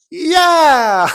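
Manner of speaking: very high, very loud squealed cheer; imitated baby's shout. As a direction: burst out like a gleeful infant. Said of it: "my eldest just goes".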